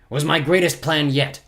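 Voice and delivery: a mild growl from the villain. A narrator's reading. Olaf boasts to Poe and orphans, a light growl. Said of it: "Count Olaf growled".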